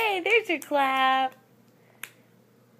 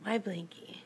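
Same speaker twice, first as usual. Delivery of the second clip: whisper; 3 semitones lower, 9 dB quieter than usual. Real, not acted. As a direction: whisper it like a secret. Mother whispers about toy.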